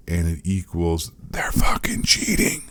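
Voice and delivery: unvoiced whisper of the verdict; whispered punchline. Vlogger whispering that they are cheating. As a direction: whisper it like a dirty secret.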